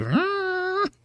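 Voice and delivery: thin, very high sustained whine; imitated demon whine. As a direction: hold a soft, spooky high-pitched "eee". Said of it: "spooky high-pitched noises".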